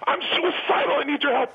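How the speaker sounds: high, pleading cry for help; desperate stranger. Retold or imitated. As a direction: beg like a desperate fan. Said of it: "He goes".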